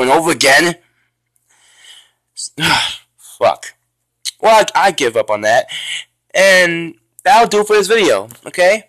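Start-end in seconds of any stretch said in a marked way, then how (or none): none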